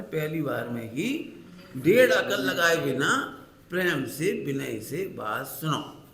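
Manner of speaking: slow, deliberate teaching voice; slowly. Shown as a demonstration. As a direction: space out every word slowly. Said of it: "हम धीरे धीरे".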